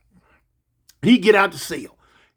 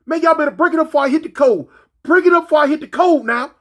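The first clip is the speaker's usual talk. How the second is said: high, loud, fast yelled warning; mock shout to break up a fight; shouted order; 7 semitones higher, 4 dB louder, faster than usual. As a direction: bark it fast over the radio. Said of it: "I said".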